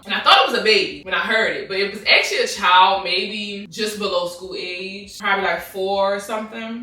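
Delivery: usual speaking voice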